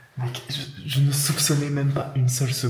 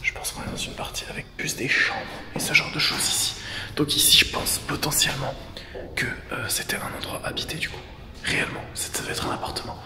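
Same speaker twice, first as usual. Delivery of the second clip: whispering. Real, not acted. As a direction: whisper so nobody hears. Visite urbex chuchotée. (chuchotement discret)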